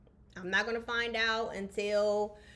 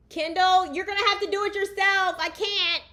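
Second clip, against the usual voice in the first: high, loud yell to another room; real raised-voice call across the house; 11 semitones higher, 9 dB louder than usual. Real, not acted. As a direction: holler to someone out of earshot, quick and exasperated. Calls to someone off mic mid-recording.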